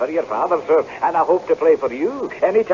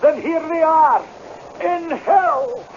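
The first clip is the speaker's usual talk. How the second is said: raised, high defiant retort; defiant outburst; 9 semitones higher than usual. Acted. Tenant hurling defiance at the laird, high and raised. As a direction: fling it back at the laird in defiance.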